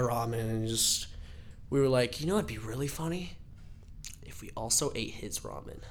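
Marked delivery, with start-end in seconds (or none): 2.2-5.8 soft, quick, conspiratorial; hushed scheming. Retold or imitated. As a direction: plot it quietly like a prank. Friends plotting to eat his ramen.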